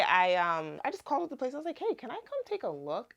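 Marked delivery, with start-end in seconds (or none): 1.8-3.1 higher-pitched polite asking; lightly acted sweet request; perky phone request. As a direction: imitate a quick, high-voiced call to ask. Phoning the place, acted request.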